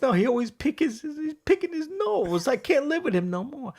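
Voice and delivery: put-on high, fed-up Italian-accented wife; old Italian woman. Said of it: "she brings the old Italian woman in".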